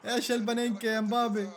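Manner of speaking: normal talking voice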